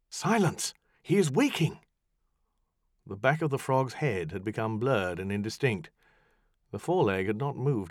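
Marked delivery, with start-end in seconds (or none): 0.1-1.8 raised sharp command to be silent; high, tense warning; urgent hush. Acted. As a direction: cut them off, he stirs. Hushing the others urgently.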